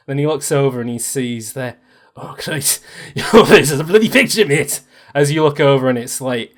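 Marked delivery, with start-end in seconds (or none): 2.2-4.8 appalled reaction. Acted. GM acts Bradley appalled at face.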